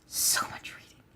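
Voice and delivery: tired whisper. Real, not acted. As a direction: breathe it out.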